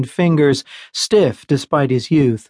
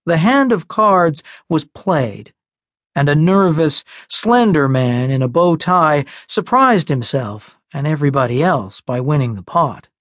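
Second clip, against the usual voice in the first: usual speaking voice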